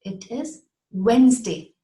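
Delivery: two-syllable Wednesday, no D; correct Wednesday. Shown as a demonstration. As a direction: firmly give the right Wednesday, WENZ-day. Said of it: "two syllables".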